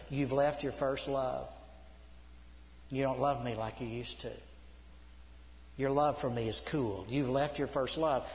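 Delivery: tender reproach. Acted. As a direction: grieve gently over a lost love. Voices Jesus reproaching a church.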